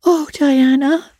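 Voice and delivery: put-on high, drawn-out girlish cry; emotional cry. A narrator's reading. Anne hears Diana's vow of love, emotional cry. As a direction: cry out her name, slowly and high. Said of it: "cried Anne".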